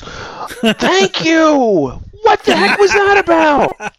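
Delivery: high, bursting exclamation of agreement; excited outburst. Real, not acted. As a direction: jump in high and eager, finally someone said it. Agreeing about weird accent.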